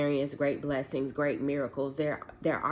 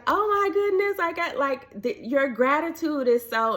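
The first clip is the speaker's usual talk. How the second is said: far higher, gushing exclamation; overwhelmed caller; 12 semitones higher, 3 dB louder than usual. Retold or imitated. The caller overwhelmed by her gratitude, squealing. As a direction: squeal it out, overcome.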